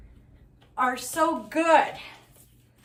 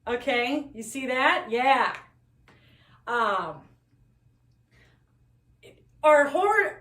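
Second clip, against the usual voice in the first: ordinary talk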